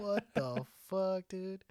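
high, drawn-out what the fuck; retold shock. Coworker sees his friend's swollen purple hand, shocked. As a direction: stretch it slowly in stunned disbelief.

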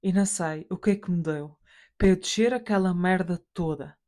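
ordinary delivery

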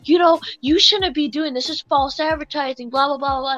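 loud, outraged customer tirade; hammed-up indignant ranting; mock yelling rant. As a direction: imitate a customer blowing up at the cashier. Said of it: "you're yelling at me".

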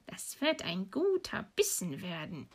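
hushed, gloating witch mutter; muttering to herself. Acted. Witch over the sleeping children, muttering to herself. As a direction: murmur it softly, relishing the prey. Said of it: "so murmelte sie vor sich hin".

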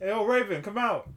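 calling out. Retold or imitated. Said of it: "So it's like".

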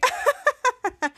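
high-pitched staccato mock laugh; imitated laughing. Somebody laughing at scared Henry, imitated laughing. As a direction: act out a prankster's high cackle. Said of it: "somebody was laughing".

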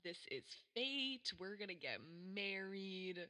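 put-on slow, dreamy lovestruck teen; lightly voiced smitten teen; infatuated schoolgirl. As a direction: swoon over him like a lovesick teenager. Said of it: "high school level infatuation where you're like".

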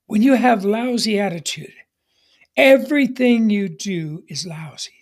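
normal talking voice

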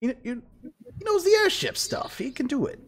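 very high squeaky insistence. Acted. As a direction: squeak it out eagerly. GM voices Jocelyn.

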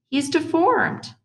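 high, stretched-out protest; blurted objection. A narrator's reading. Boy arguing with his mother about the new kid, high protest. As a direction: draw it out high, insisting.

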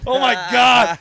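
loud, high oh my god; mock horror. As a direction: gasp it in embarrassed shock. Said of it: "And I was like".

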